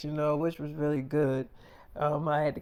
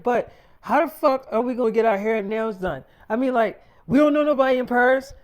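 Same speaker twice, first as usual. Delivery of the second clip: panicked complaint; 5 semitones higher, 4 dB louder than usual. Retold or imitated. Wife panics about hair in Paris.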